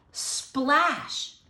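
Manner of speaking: plain reading voice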